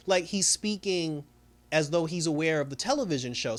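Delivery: usual speaking voice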